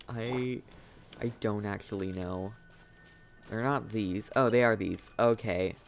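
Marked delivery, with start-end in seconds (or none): none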